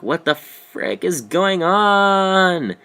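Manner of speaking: high, loud, very slow disbelief; mock shock. Watching him undress, high slow shocked outrage. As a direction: stretch out the outrage. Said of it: "I'm like".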